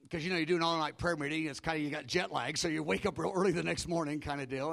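normal talking voice

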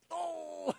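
high, soft drawn-out "oh"; high moan, held back in volume; groan of pain. Retold or imitated. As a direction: re-enact a sudden pained moan. Pain strikes mid-conversation, retold.

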